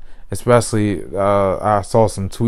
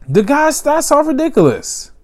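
much higher, incredulous repeat; excited emphasis. Real, not acted. Host raving about Trask's stats, incredulous. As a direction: pitch it up in disbelief. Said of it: "repeats with much higher pitch".

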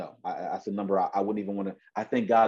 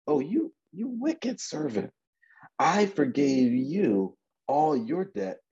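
high, slow, drawn-out scolding; indignant rebuke; 6 semitones higher, slower than usual. Acted. Lord confronting his wicked servant, indignant rebuke. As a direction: stretch the words out in reproach.

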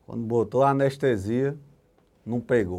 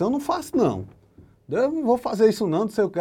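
much higher, protesting no; panicked refusal; 7 semitones higher than usual. Retold or imitated. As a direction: refuse it like a scared kid, pitched way up. Recusa exame, imita a si mesmo. (recusa assustada, voz bem mais aguda)

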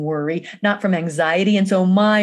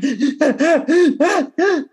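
a high laugh breaking through crying; tearful laugh; 8 semitones higher, 3 dB louder than usual. Real, not acted.

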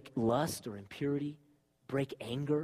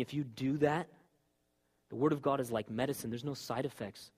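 ordinary delivery

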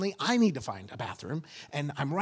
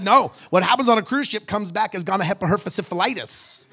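loud, raised comic warning; emphatic rebuke; 7 semitones higher, 12 dB louder than usual. Retold or imitated. Pastor warning girl about cruise hookup, loud mock rebuke.

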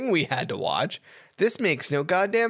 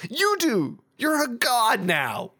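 exasperated rant; 7 semitones higher than usual. Real, not acted. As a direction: insist he is a god, fed up. Ranting at Doom.

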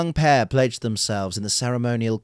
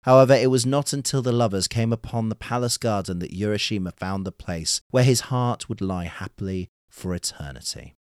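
ordinary delivery, nothing marked

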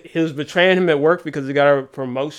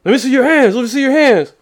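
high, loud barked order; shouted police command; cop shouting; 10 semitones higher, 7 dB louder than usual. Retold or imitated. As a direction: bark it like a cop at a stop. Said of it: "they go like".

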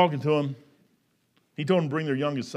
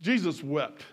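usual speaking voice

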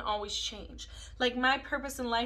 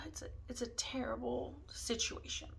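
whisper; 11 dB quieter than usual. Real, not acted. Sighs, drops to a whisper.

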